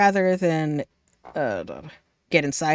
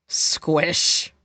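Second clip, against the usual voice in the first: ordinary talk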